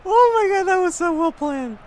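high, loud, almost crying with joy; thrilled cry. Real, not acted. Gamer gushing over his planned goal, high and loud.

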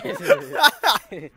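breaking up laughing; words lost in laughter; laughing through words. Real, not acted. Laughing fit.

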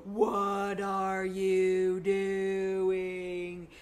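stretched out, drawn slow; slow motion. Retold or imitated. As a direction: say it like time froze. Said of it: "time slows down and I go".

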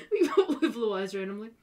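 words broken by laughter; laughing. Real, not acted. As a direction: laugh through the line. Laughing at the thumbnail title.

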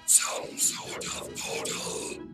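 slow, toneless whispery robot; robotic henchman. Acted. Soundwave reports to Shockwave, robotic. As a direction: answer slowly as a machine.

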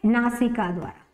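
ordinary talk

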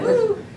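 high, sudden whoop; startled "woo" yelp. Retold or imitated. Actress recalling cold metal on her arm, high startled yelp. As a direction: yelp as if touched by icy metal.

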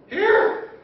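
high, loud eager offer; eager call. Acted. Nervous disciples handing Jesus the plate, eager call. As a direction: thrust it out with a quick shout.

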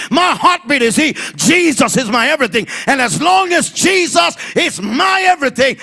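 preaching at full force. Acted. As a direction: shout the faith over every obstacle. Preaching climax.

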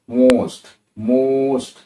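মোস্ট with a loud, stretched O; exaggerated teacher's demo of মোস্ট, long round O; drawn-out long O. Shown as a demonstration. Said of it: "এই স্টাইলে বলছি আমরা".

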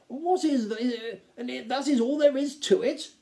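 grand count, baffled; incredulous count. A narrator's reading. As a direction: stumble into a disbelieving question. Count voiced, puzzled by the book.

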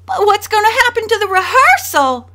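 high, loud petulant wail. A narrator's reading. Spoiled daughter complaining to her mother, wailing loudly. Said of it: "Donna May wailed".

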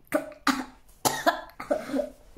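choking on something; gagging with hand at mouth; coughing while spitting out. Real, not acted. Seen 3: No pouch visible; she coughs and spits into her cupped hand, grimacing.

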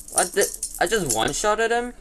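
high, loud amazed outburst; surprised. Real, not acted. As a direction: blurt it out in disbelief. Pig dies in one hit.

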